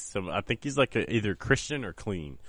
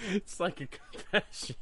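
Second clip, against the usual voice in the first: laughing through the words, higher; giggling it out, slow; 9 semitones higher, 9 dB quieter than usual. Real, not acted. Laughing over the diary reveal.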